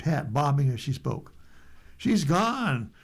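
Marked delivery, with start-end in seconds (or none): 2.0-2.9 higher, lightly raised alarmed call; a reporter calling out, understated; mock shout. A narrator's reading. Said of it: "Miklaszewski of N B C shouted".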